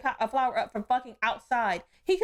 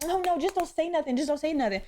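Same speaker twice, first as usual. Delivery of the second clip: lightly voiced higher-pitched conflict avoider; mocking timid people; 4 semitones higher than usual. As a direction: mimic a meek hush-up, pitched up. Mocks people who avoid confrontation.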